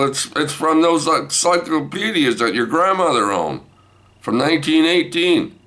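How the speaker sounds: high, stammered excuse; defensive explaining. Retold or imitated. Grandpa excusing himself to wife, high defensive voice.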